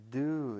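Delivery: "do" punched out loud; stress on DO. Shown as a demonstration. Lecturer giving the contrast that is elicited, with stress on "do". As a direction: hit "do" hard. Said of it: "is elicited".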